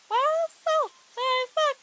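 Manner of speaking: very high put-on squeak; faltering try at a squeaky voice; trial weird voice. Shown as a demonstration. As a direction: pitch it far up, testing.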